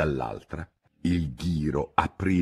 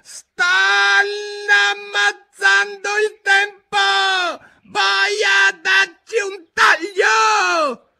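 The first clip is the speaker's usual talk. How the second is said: very high, loud, stretched-out yell; shrieked royal order in broken rhythm; syncopated shout; 9 dB louder, much slower than usual. A narrator's reading. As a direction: scream it as the outraged Queen, beat by beat. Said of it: "gridò con voce sincopata".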